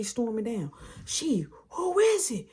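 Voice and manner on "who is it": high-pitched demanding call; retold maternal challenge; suspicious mom. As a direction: imitate a mother storming in. Mom storming down, acted.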